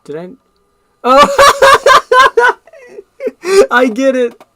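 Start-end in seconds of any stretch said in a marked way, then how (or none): 1.0-3.7 laughing hard. Real, not acted. Laughing at own joke. 3.7-4.4 laughing. Real, not acted. Laughing at own joke.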